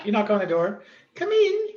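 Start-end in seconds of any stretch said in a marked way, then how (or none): 1.2-1.7 high, genteel lady calling come in; lady's voice. Acted. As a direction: sing out a gracious welcome. GM voices Lady Victoria answering door.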